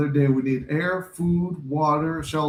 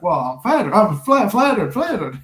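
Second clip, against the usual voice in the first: high, louder, stammered flattered; flustered Sherman; 5 semitones higher, 5 dB louder than usual. Retold or imitated. Sherman flustered when a girl flatters him, high stammer. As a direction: stumble over the word, flustered and giddy. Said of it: "he's like".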